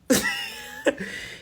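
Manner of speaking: short high-pitched laugh; quick high laugh at her own words. Real, not acted. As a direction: burst into a squeaky giggle.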